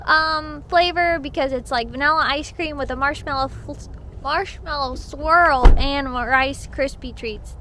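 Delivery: usual speaking voice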